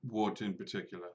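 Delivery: low, soft in-character reply; Mordru, guarded and terse. Acted. DM voices Mordru answering William.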